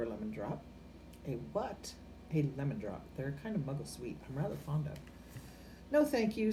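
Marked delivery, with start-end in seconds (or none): 5.9-6.5 cold refusal. A narrator's reading. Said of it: "said Professor McGonagall coldly".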